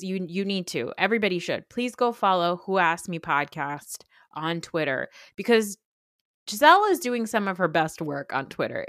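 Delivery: ordinary delivery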